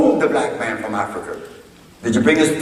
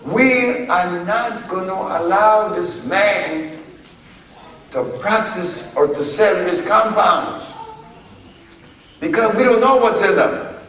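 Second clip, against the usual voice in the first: mocking the FDA; 7 semitones higher than usual. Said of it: "the F D A now jumped in".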